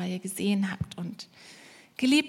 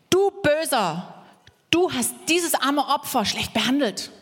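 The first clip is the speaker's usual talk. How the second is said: higher, slow, drawn-out theatrical reproach; scolding rescuer; 4 semitones higher than usual. Acted. Preacher as rescuer scolding the villain, theatrical. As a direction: rebuke the villain like a hammy hero. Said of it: "Und dann kommt der Retter".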